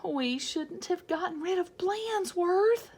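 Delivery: put-on a touch of kids' regret; regretful kids.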